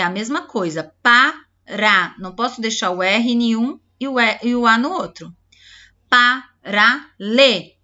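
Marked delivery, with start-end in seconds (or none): 1.0-2.1 "pá, rá" as two firm, louder beats; "pá, rá" louder, with a gap between; separate syllables. Shown as a demonstration. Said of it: "tem que respeitar o som". 6.1-7.8 "Pá, rá, lê" as three loud, separate beats; "Pá, rá, lê" louder, a gap after each; separate syllables. Shown as a demonstration. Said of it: "tem que respeitar o som".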